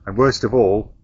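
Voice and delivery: low, loud movie-trailer announcer; dramatic trailer voice. Acted. Dramatic intro to the video.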